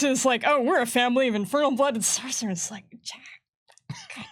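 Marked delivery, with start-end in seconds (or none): none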